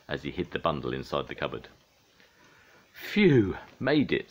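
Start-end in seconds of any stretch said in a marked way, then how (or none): 2.9-4.3 loud, high "phew" of relief; sighed relief. A narrator's reading. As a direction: let out a big storybook sigh of relief. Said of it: "sighed Pingu".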